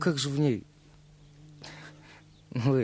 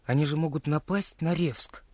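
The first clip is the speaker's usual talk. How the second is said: usual speaking voice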